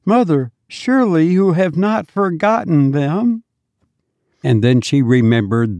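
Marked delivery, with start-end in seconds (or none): 0.0-3.4 put-on high, sweet little child calling his mother; a small dead child greeting his mother with joy; child's voice. A narrator's reading. Dead child to his weeping mother, high sweet child voice.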